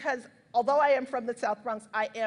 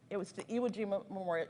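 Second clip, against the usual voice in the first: ordinary talk, nothing marked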